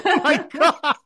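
high, laughing oh my God; laughing disbelief. Real, not acted. Host reacting to the no-cuddling upbringing, high laughing disbelief.

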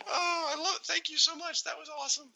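high, tearful laughing. Real, not acted. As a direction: laugh through the words. Cracking up at letter.

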